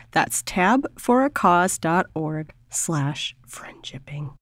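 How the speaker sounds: slow breathy whispered tagline; hammy sexy whisper. Acted. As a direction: whisper it low and seductive like a ham. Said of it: "I felt like hamming it up a little".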